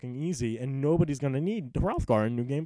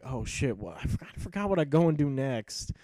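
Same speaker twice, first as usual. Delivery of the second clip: confused; 5 semitones higher than usual. Retold or imitated. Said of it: "I was kinda like".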